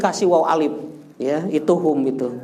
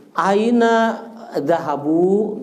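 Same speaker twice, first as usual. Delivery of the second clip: normal talking voice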